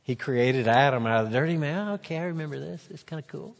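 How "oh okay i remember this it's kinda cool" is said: amused inner aside. Acted. Jesus' inner thoughts, playfully voiced.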